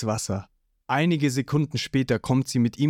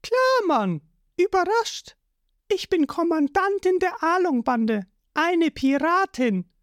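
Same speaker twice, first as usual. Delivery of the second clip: put-on much higher, proud girl's voice; Nami's voice. A narrator's reading. Nami revealing herself as a pirate, high and proud. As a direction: announce it high and boastful.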